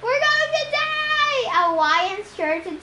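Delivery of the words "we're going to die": very high panicked shriek; a terrified passenger screaming; panicked yelling. A narrator's reading.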